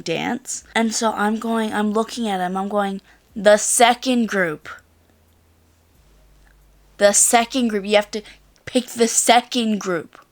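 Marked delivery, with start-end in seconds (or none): 3.3-10.2 emphatic, slightly louder insistence; mildly exasperated, stressed and deliberate; retold annoyance. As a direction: insist as if it's obvious. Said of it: "I was getting actually annoyed".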